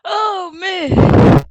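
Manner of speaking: loud, high burst through real laughter; can't hold the laugh in; laughing exclamation. Real, not acted. Laughing out loud at the bonobo picture. Cracking up at the photo.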